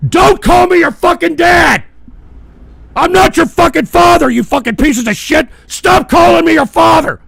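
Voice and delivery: loud, high furious yelling; enraged shout. Real, not acted. Host rejecting viewers who call him dad, enraged shout. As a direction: yell it, outraged and indignant.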